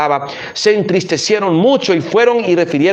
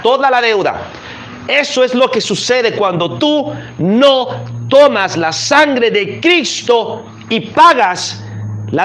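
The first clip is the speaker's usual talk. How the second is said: preaching; 5 semitones higher than usual. Acted. Preacher urging the listeners to forgive, fervent preaching. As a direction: drive the message home to the congregation. Preacher applies parable with rising force.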